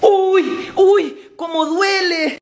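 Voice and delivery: acting out a smarting hurt; pained whimper. Child in pain after punishment. (quejido agudo de dolor)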